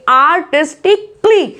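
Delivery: आर्टिस्टिकली slowly, ending "tik-klee"; correct pronunciation demo. Shown as a demonstration. Teacher giving learners the right form, carefully pronounced. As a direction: close आर्टिस्टिकली on a tight क्ली. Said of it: "क्ली करके आवाज आती है".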